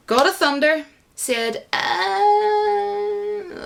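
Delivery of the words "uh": high scoffing grunt; a high dismissive uh. Retold or imitated. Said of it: "like that".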